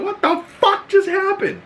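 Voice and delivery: high squeaky burst of astonishment; shocked disbelief. Retold or imitated. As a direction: exclaim it way up high, utterly baffled. Acts out own shocked reaction.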